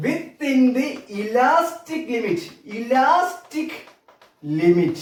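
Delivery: very slow, words drawn out with pauses; slow dictation. Real, not acted. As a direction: spell out the new term for a class, word by word. Teacher repeats new term for class. (ക്ലാസ്സിന് പറഞ്ഞുകൊടുക്കും പോലെ വളരെ പതുക്കെ, നിർത്തി നിർത്തി)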